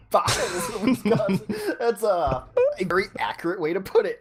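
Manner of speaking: amused, laugh-broken delivery. Real, not acted. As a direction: repeat it while cracking up. Cracks up at co-caster's jab.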